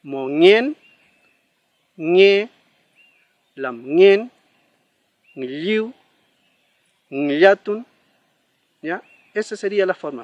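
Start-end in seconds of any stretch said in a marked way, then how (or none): 0.0-7.8 each word with ng, louder, for learners to echo; repeat with ng. Shown as a demonstration. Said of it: "Vamos a repetir las mismas palabras".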